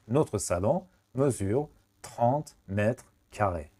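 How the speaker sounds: slow, whole-sentence model read; slow teacher read; very slow full sentence. Shown as a demonstration.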